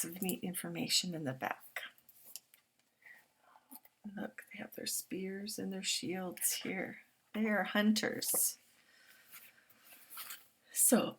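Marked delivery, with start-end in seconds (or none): none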